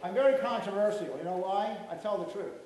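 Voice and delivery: away from the microphone; off-mic. Real, not acted. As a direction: talk from across the room. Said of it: "I'm gonna get away from the mic".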